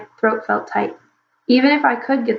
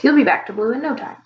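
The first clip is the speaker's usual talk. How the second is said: overly hearty; 3 semitones higher than usual. Acted. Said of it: "overly hearty voice".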